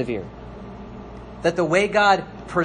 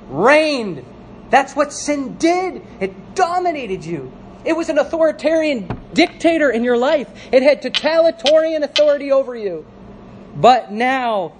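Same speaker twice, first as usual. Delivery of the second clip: raised, forceful pulpit declaration; emphatic preaching; 8 semitones higher, 3 dB louder than usual. Real, not acted. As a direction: hammer each key word to the congregation. Preaches sin's dominion emphatically.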